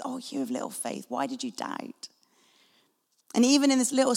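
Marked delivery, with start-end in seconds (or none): 0.0-2.1 gentle rebuke. Retold or imitated. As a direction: lower and softer, tender reproach. Jesus gently rebukes Peter.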